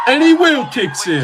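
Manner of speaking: laughing excitement. Real, not acted. Watching the kick replay.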